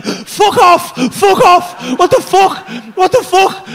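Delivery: high, loud disbelieving yell; loud retold freak-out; shocked yelling. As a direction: shout in total disbelief. Shocked at cartoon world.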